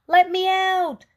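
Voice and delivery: high, loud, tiny cookie voice crying out; odd little voice crying. A narrator's reading. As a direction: cry out from inside the oven, tiny and shrill with panic. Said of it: "cried an odd little voice".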